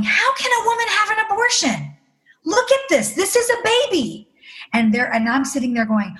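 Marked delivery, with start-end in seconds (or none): 0.0-4.3 high-pitched imitation of appalled women. Women at volunteer training reacting to the embryo pictures, appalled. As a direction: gasp it out high, like scandalized trainees. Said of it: "these precious women ... going".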